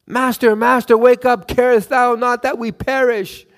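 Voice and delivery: high, loud, desperate cry to wake him; panicked plea. Acted. Terrified fishermen begging sleeping Jesus, high panicked cry. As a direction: beg like a man sure he's about to drown. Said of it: "fishermen were crying like babies".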